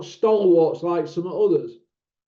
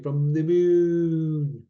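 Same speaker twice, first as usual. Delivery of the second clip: Manchester accent with a long held "moon"; lightly hammed-up Manchester accent; much slower than usual. Shown as a demonstration. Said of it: "That's my Manchester accent".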